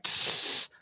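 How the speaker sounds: unvoiced hiss of a spray can. Shown as a demonstration. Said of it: "I'm doing the spray paint sounds".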